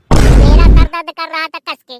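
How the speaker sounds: slow, loud furious yell; angry shout. Acted. Wife shouting angrily at husband over her headache. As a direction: bellow your complaint.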